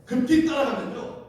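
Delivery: high, urgent fake helpfulness; putting on an act. Rahab misleading the detectives into a chase, high urgent act. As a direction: send them off with hammed-up urgency. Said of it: "연기를 기가 막히게".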